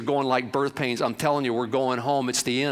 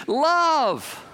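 Love said high and falling slowly; single word Love, held and lifted; drawn-out Love; 14 semitones higher, 3 dB louder than usual. Acted. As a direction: lift Love up high, then let it fall. Preacher stressing one word.

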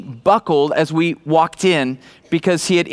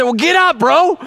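excited call; 9 semitones higher, 3 dB louder than usual. Retold or imitated. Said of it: "And I said".

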